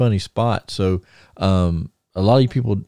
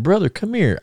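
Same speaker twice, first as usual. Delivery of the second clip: much higher, eager retold call-over; 11 semitones higher than usual. Magnum at his gimmick table to the teller, eager call. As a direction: call him over, excited.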